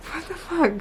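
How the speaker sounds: a squeal of outraged disbelief; incredulous. Real, not acted.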